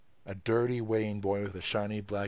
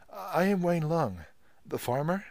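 with difficulty; 9 semitones higher, 5 dB louder than usual. Acted. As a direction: force the words out nervously. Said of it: "With great difficulty".

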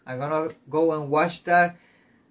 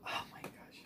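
whispering; 23 dB quieter than usual. Real, not acted. Whispered gasp at the start.

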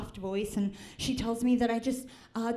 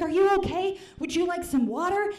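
put-on higher, louder sing-song old woman checking in; high caring old woman; 7 semitones higher, 4 dB louder than usual. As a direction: play the fussing old waxer, pitched up. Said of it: "She's saying things like".

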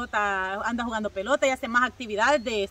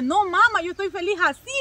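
higher, louder, mock kid protest; son's reply; 6 semitones higher, 4 dB louder than usual. Mother imitating her son refusing vitamins, higher and louder. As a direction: play the son brushing it off. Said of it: "Y me dice".

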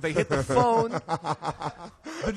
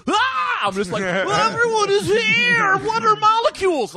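high, loud awestruck stoner; stoned wonder; 9 dB louder, slower than usual. Retold or imitated. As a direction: marvel at everything as a stoned kid. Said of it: "I get high like a twelve-year-old".

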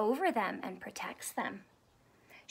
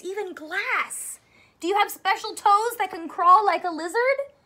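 ordinary delivery, nothing marked

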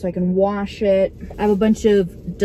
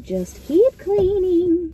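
sing-song pep talk; 9 semitones higher, much slower than usual. Pretend. Self-motivating chant.

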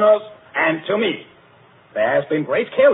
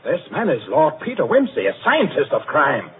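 ordinary delivery

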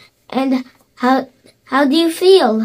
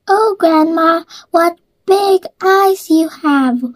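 put-on little girl voice; 6 semitones higher than usual. Girl wondering at eyes.

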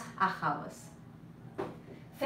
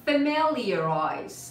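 FAMILIARIZE drawn out very slowly; slow model pronunciation; 4 dB louder, much slower than usual. Shown as a demonstration. Teacher asks students to repeat after her, slow model pronunciation. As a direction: stretch "Familiarize" for learners to repeat. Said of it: "try to repeat after me".

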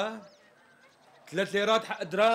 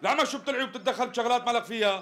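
higher-pitched, heated rebuke; angry scolding; 4 semitones higher than usual. Acted. Customer orders the assistant to mind his business, angrily. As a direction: tell him off for butting in.

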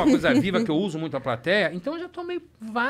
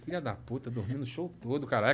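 low, soft grumble under the breath; muttered cursing; 9 semitones lower, 9 dB quieter than usual. Retold or imitated. Comedian to himself about a sleeping spectator, muttered cursing. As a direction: curse privately, keep it down.

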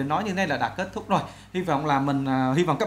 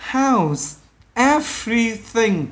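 "How's everything" very slow, word by word; drawn-out citation form of "How's everything"; unlinked words; 3 semitones higher, much slower than usual. Shown as a demonstration. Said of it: "Bình thường nó là".

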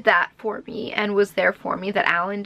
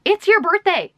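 higher, quick mock of her boyfriend insisting; 9 semitones higher, 4 dB louder than usual. At the store, boyfriend insisting he pays, quick and higher. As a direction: replay him talking her into it. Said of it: "He's like".

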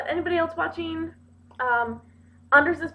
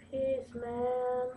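hippie; 8 dB quieter than usual. Pretend. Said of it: "They call this the hippie stash".

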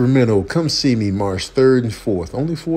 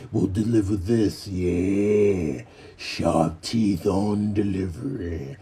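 low, slightly soft taunt; hammed-up low menace; mock tough guy; 3 semitones lower, 5 dB quieter, slower than usual. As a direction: needle the postman, low and slow. Voices menacing the postman.

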